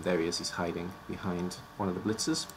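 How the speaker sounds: ordinary talk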